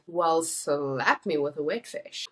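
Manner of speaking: slow, drawn-out mock surprise; sarcastic. Shown as a demonstration. Host showing how the translated phrase is used, mildly sarcastic. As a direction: draw it out with mild sarcasm. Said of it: "surprised or because you're sarcastic".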